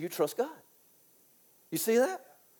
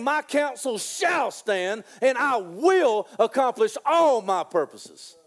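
slow proclamation; slower than usual. Acted. Repeats proclamation.

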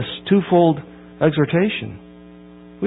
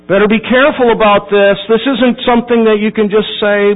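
louder and higher, pressing the point; a raised preacher's caution; stern warning; 6 semitones higher, 5 dB louder than usual. Real, not acted. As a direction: warn them to take this seriously. Warning the congregation.

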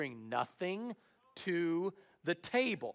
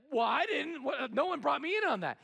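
indignant Sanj; 9 semitones higher, 3 dB louder than usual. Retold or imitated. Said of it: "And Sanj is like".

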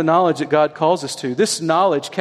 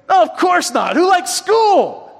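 put-on kid's shout; 10 semitones higher than usual. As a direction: shout it like everyone hates school. Son answers dad about school.

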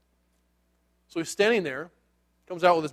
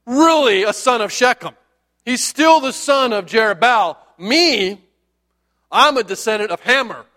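higher-pitched, mouthy bragging; talking big; 4 semitones higher than usual. Acted. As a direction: play a drunk big talker puffing himself up. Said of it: "He starts talking big".

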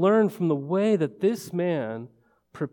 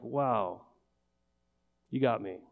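grudging wow; 4 semitones lower, 7 dB quieter than usual. Retold or imitated. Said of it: "what tone of voice are you gonna use".

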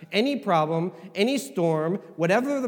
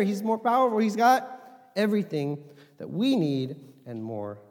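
normal talking voice

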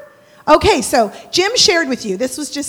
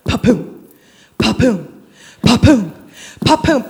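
punchy "pa-poom" drum imitation; imitated bass thump; 3 semitones lower, 5 dB louder than usual. Neighbor hearing the party's bass, imitated booming thuds. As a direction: thump out the beat with pauses between. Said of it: "pretty soon I hear".

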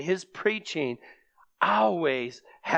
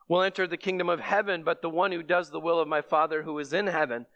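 usual speaking voice

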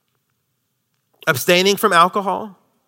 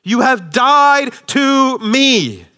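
defiant; 8 semitones higher, 4 dB louder, much slower than usual. Acted. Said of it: "Paul says".

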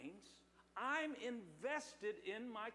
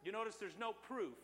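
normal talking voice

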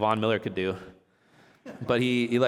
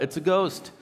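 higher, mildly alarmed cry; fearful cry; 6 semitones higher than usual. Acted. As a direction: cry out in a touch of fright. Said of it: "in their fear, they cried out".

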